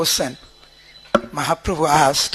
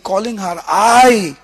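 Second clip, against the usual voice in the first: ordinary talk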